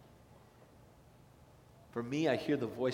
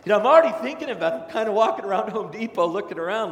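fast, louder and higher with excitement; eager rush; 7 semitones higher, 9 dB louder, faster than usual. Real, not acted. Pastor telling church about browsing smokers, excited rush. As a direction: gush about the new toy.

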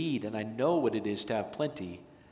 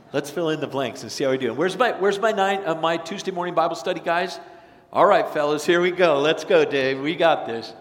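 rallying cheer; 5 semitones higher, 7 dB louder than usual. Real, not acted. Rallying congregation for quiz.

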